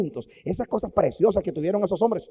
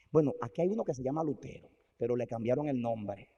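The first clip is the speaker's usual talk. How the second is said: usual speaking voice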